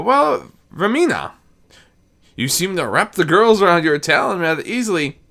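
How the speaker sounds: a hint of an amused old daedric prince; Sheogorath. Sheogorath speaks to Discord.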